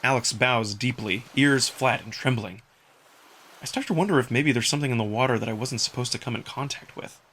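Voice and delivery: ordinary delivery, nothing marked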